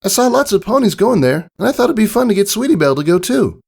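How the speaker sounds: high, fast eager filly voice. Acted. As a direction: rush it out like an excited young pony. Scootaloo keeps talking.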